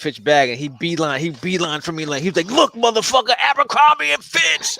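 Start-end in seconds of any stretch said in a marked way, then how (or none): 2.5-4.8 mock angry roommate. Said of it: "That shit made him so mad".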